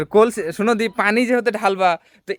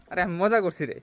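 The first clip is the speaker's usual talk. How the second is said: ordinary talk, nothing marked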